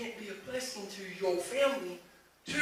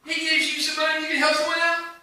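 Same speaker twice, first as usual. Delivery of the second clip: much higher, louder preaching build; preaching at full force; 9 semitones higher, 15 dB louder than usual. Real, not acted. Preacher building to a climax before the congregation, fervent. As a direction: drive the point home to the congregation.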